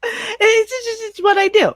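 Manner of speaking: laughing through the words. Real, not acted. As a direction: giggle while answering. Laughing while answering.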